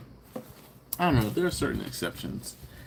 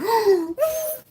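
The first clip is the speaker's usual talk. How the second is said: loud, high shocked gasp; hammed-up high intake of surprise; dramatic gasp; 5 dB louder than usual. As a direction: gasp big in mock surprise. Acting out reaction to Susie's date.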